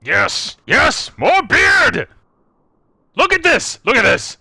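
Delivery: gleeful shout, high and loud; greedy glee. Acted. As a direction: crow with delight over the beard. Cheered character admires snow beard.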